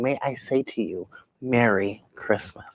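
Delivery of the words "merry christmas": ordinary talk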